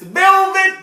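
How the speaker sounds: very high squealed velvet; huge shocked squeak on velvet; mock horror. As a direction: shriek velvet in disgust. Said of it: "I was like".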